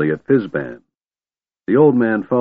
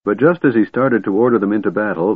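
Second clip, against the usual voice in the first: usual speaking voice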